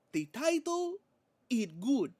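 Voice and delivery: put-on high, halting Spanish-accented promo voice; Penta promo impression. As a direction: imitate a wrestler's promo in a thick Spanish accent. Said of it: "my accent is terrible".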